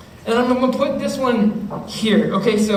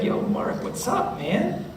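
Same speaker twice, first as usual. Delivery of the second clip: friend asking quietly, half whispered; mock hushed; 8 dB quieter, slower than usual. Friend checks on Mark, quietly asking what's up.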